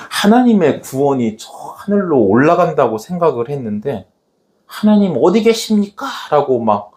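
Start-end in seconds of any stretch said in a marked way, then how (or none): 4.6-6.0 high-pitched acted outcry; crying out. Elisha striking the water, acted crying out. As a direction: call out desperately to God. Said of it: "외치면서, 부르짖으면서".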